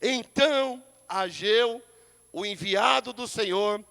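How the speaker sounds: slow, solemn, weighty Bible reading; scripture reading. Acted. Pastor reads Haggai to the congregation, slow and solemn. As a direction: read each name slowly from the pulpit.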